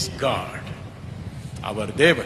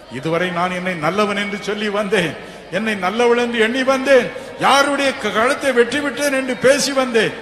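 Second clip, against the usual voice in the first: ordinary delivery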